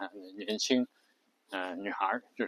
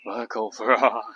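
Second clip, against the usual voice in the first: vocal fry; 3 dB louder than usual. Shown as a demonstration. Teacher demonstrates the term in creaky vocal fry. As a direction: let the words rattle in the throat. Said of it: "这叫vocal fry".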